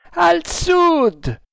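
very high, long call; shouted bearing. A narrator's reading. Lookout giving the ship's direction, shouting. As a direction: sing out the direction from aloft.

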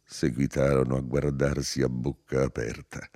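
plain reading voice

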